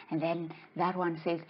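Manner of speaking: ordinary talk, nothing marked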